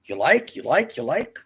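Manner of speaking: imitating shopkeeper. Storyteller imitating the saleswoman offering the cane, coaxing and high. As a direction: push the cane on him like a pushy saleswoman. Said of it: "she goes".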